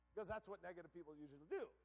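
ordinary talk, nothing marked

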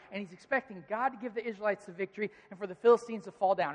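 ordinary talk, nothing marked

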